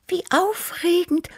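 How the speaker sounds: high, thrilled little cat voice; Tibi. A narrator's reading. Cat Tibi thrilled by the adventure tale, purring high. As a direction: purr it high and delighted. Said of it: "schnurrte Tibi".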